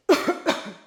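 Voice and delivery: sharp cough mid-workout. Real, not acted. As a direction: clear the throat with a cough.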